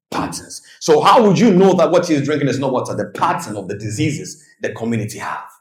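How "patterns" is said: a whispered key word. Real, not acted. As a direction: breathe the word out.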